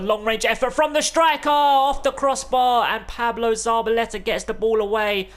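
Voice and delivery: rising thrill as it hits the bar; excited commentary. Real, not acted. Shot hits the crossbar.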